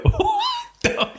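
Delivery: squeaked out in a very high, laughing voice; mock outrage in a high laugh; shocked squeak. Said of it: "I'm like".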